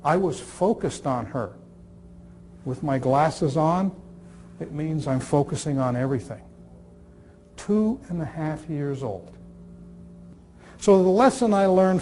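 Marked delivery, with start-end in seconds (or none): none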